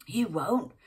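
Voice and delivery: put-on loud angry snap back in a London accent; snapped back. A narrator's reading. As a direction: throw it back at him hard. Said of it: "snapped back Sally".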